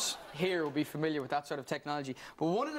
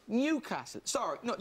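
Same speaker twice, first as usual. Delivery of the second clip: ordinary talk, nothing marked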